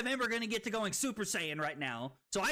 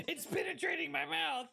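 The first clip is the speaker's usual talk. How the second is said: high, giggling outcry; laughing; 8 semitones higher than usual. Real, not acted. Streamer to chat about their mouth, laughing outcry. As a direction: squeal it through laughter. Helpless laughter at own mishap.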